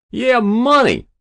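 very high, loud, falling cry; greedy lout. A narrator's reading. Hero confirming to the senator, greedily.

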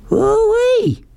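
very high falsetto holler; screamed whoop. A narrator's reading. Somebody whooping from a speeding Jeep, very high holler. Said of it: "somebody screamed".

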